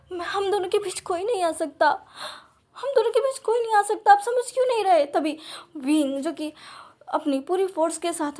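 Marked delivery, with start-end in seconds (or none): 0.1-5.0 desperate plea. Acted. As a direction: plead until it breaks into a cry. Husband pleads, rising to a yell. (ऊँची, ज़ोरदार बेबस पुकार)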